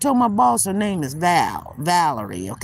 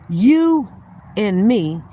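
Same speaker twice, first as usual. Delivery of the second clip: ordinary talk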